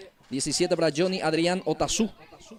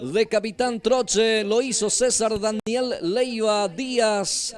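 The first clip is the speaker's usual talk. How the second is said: excited goal call; 2 semitones higher, 4 dB louder, slower than usual. Real, not acted. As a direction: stretch the scorer's name out proudly. Naming the goal scorer.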